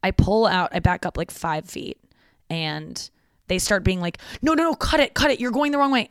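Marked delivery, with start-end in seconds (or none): 4.4-6.1 retold frantic yelling. Friends shouting at the reversing driver, alarmed yell. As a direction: warn her off like she's about to crash.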